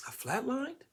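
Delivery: can't believe what you just heard; shocked question. Retold or imitated.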